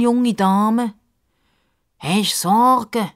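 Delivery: high, slow, loud creature voice; friendly crocodile. Acted. Crocodile Justus introducing himself to the girl, slow and friendly. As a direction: introduce yourself grandly and kindly.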